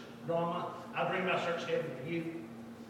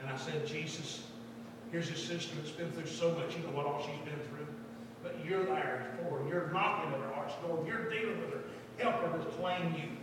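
retold quiet prayer; 2 semitones lower, 4 dB quieter than usual. Preacher praying over girl at altar, hushed. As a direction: pray gently and earnestly.

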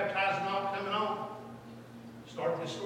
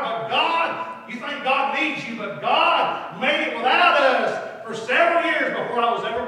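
slow, emphatic preacher's delivery, swelling louder; fervent preaching; 7 semitones higher, 10 dB louder, slower than usual. Acted. Preacher urging the congregation not to turn back, fervent preaching. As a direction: stretch the key words with full-force conviction.

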